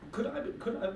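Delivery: put-on high voice of a shy old lady; woman's voice. Joke teller voicing the woman asking the shopkeeper, high. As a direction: lift it high for Missus Flanagan.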